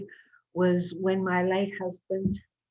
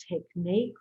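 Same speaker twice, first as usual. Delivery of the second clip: normal talking voice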